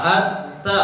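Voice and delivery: At-ta with a light ta, tongue tip on the back of the teeth; crisp light doubled t in At-ta; teacher models At-ta with a light ta; model syllable at-ta. Shown as a demonstration. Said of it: "Ujung lidah menempel di gigi belakang".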